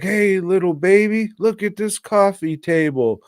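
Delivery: put-on high-pitched dad voice for a baby; retold cooing, showing off to a baby; baby talk. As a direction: pitch it up as if for an infant. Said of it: "and he's like".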